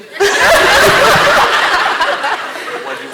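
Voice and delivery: a loud, high burst of real laughter. Real, not acted.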